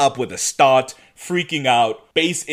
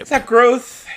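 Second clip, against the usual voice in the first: incredulous; 10 semitones higher, 7 dB louder than usual. Real, not acted. Skeptical question about growth.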